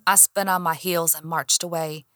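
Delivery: ordinary delivery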